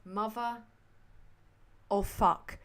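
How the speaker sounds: ordinary delivery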